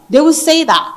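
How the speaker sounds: ordinary talk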